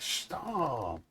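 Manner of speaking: a deadpan imitation of a dork; mock stop. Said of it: "He's like".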